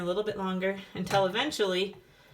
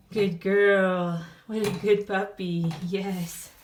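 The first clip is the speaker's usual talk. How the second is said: praising a dog; 5 dB louder, much slower than usual. Real, not acted. Praising puppy in crate.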